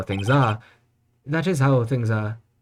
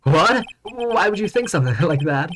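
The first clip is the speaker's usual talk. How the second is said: shocked yell; 7 semitones higher than usual. Acted. Judge character outburst.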